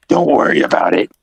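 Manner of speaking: dismissive truck. Acted. Truck answering the engine, low dismissive voice.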